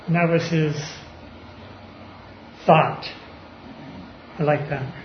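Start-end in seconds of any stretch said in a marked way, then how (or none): none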